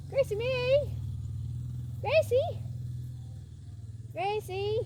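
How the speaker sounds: high sing-song call to a horse; sing-song call to the horse Gracie. Real, not acted. Calling horse from afar.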